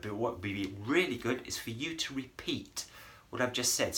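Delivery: ordinary delivery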